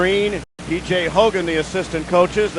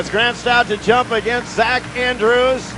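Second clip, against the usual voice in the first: announcer; 3 semitones higher, 3 dB louder than usual. Acted. Announces the game start.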